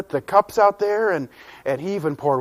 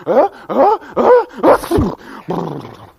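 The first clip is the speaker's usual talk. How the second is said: sneeze build-up; 6 semitones higher, 7 dB louder, much slower than usual. Acted. About to sneeze from dust.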